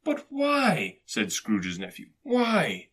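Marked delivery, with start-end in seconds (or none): 0.0-1.0 very high, bewildered question; dismayed protest. A narrator's reading. Nephew asks Scrooge why he refuses dinner, dismayed. 2.2-2.9 high, pleading question; dismayed protest. A narrator's reading. Nephew presses Scrooge for a reason, dismayed.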